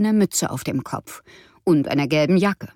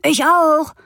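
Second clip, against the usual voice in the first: put-on higher, louder boy's shout; 9 semitones higher, 5 dB louder than usual. A narrator's reading. Big brother races to the door, shouting. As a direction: yell it to beat your sister. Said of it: "brüllte ihr großer Bruder Anton".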